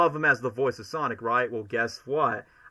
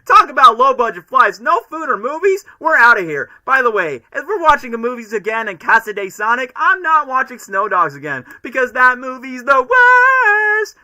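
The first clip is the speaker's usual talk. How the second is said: much higher and louder cocky hedgehog chatter; movie Sonic impression; 10 semitones higher, 12 dB louder than usual. Shown as a demonstration. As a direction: rattle it off like the wisecracking hedgehog. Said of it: "make my best movie Sonic impression".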